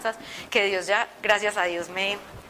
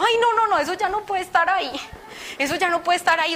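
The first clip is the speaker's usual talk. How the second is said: "no, no" in rising alarm, pitched way up; alarmed rejection; 7 semitones higher than usual. Retold or imitated. Horrified reaction to objects at home.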